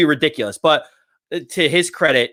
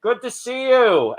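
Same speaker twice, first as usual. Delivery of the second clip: high, drawn-out mock farewell; parting call; 8 semitones higher, slower than usual. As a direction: call it back over your shoulder while leaving. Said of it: "as he's, like, walking away".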